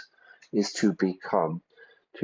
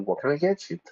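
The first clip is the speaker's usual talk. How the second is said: a hint of a high cheery waiter; waiter asking; 8 semitones higher, faster than usual. Waiter asking a pub customer, lightly voiced and high. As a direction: greet the customer as a friendly server. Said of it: "The waiter or waitress might say to you".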